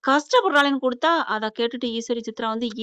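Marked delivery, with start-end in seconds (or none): none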